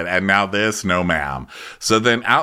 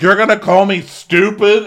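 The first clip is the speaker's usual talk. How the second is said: high, loud indignant snap; mock outrage, voice shooting up; outraged quote; 10 semitones higher, 6 dB louder than usual. Wife answering an insult, high and loud. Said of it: "Emily's like".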